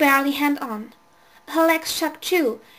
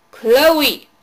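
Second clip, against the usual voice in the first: loud yell of the name; 8 dB louder than usual. A narrator's reading. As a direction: shout her name sharply. Babysitter yelling up the stairs.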